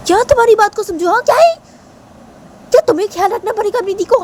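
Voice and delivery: very high-pitched acted tirade; shrill, heated quarrel; furious disbelief. A narrator's reading. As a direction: throw indignant questions at them. Tisha furiously argues back.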